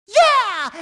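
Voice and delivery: high, loud whoop; loud, triumphant yell; cheering shout. Acted. Cheering as game character.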